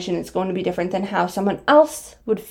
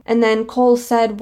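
ordinary talk

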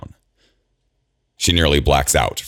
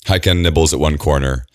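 ordinary delivery

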